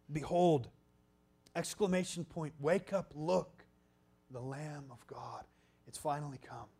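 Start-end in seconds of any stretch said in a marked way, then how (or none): none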